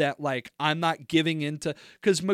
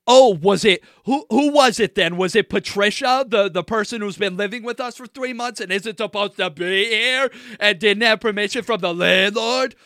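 loud retold tirade, raised pitch; heated sarcastic accusation; 4 semitones higher, 6 dB louder than usual. Retelling confronting the roommate about the unapproved houseguest, heated accusation. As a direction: pile on the pointed questions at high volume.

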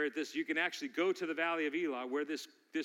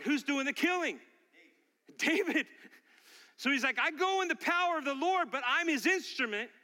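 high, driving sermon delivery; impassioned preaching; 9 semitones higher, 4 dB louder than usual. Real, not acted. Pastor to congregation on David's faith in God, impassioned preaching. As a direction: preach it with building fervour.

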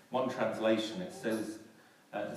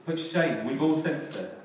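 much louder, higher preaching; impassioned pulpit delivery; full-force preaching; 4 semitones higher, 9 dB louder than usual. Acted. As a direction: hammer it out to the congregation.

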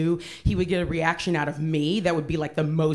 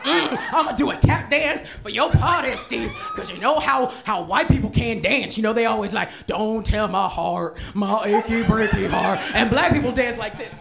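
high, loud, laughing sarcastic rage; hammed-up fury, shouted through laughter; furious mock rant; 5 semitones higher, 4 dB louder than usual. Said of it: "I got, like, so angry".

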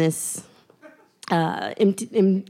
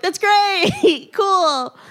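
sarcastic; 10 semitones higher, much slower than usual. Retold or imitated. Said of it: "So I was like".

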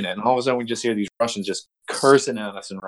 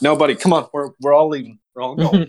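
higher, insistent herding of a buddy; pitched-up, repeated urging; urgent coaxing; 6 semitones higher than usual. Retold or imitated. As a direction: coax a stubborn pal out the door. Urging drunk buddy to leave club.

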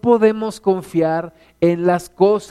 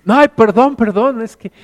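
mock flustered; 5 semitones higher, 8 dB louder than usual. Caught fraud apologizing.

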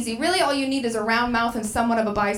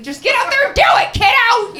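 high, loud coach pep-talk shout; 12 semitones higher, 6 dB louder than usual. Retold or imitated. Comic giving herself a coach's pep talk, shouting. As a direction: pump yourself up like a coach. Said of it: "Vince Lombardi trophying myself".

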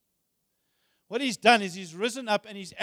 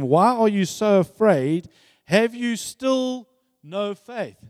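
gentle rebuke; 3 semitones higher, 10 dB louder, slower than usual. A narrator's reading. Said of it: "And he said to them".

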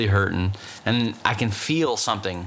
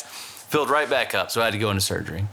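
usual speaking voice

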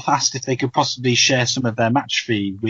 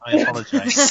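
laughing; 12 semitones higher than usual. Real, not acted. Laughs at own blunder.

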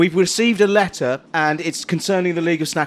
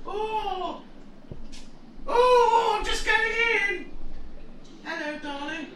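scribe voice; 11 semitones higher, 8 dB quieter than usual. Acted. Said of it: "this character".

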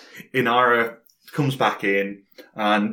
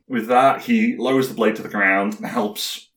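normal talking voice

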